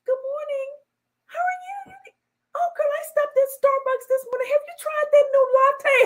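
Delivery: very high chirpy small talk; mock the phony coworker; fake sweet voice.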